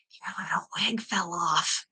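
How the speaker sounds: a discreet whisper, soft and slow; whispered quote. Retold or imitated. Doctor discreetly warns her the hairpiece fell, whispering. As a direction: hush it so the patient can't hear.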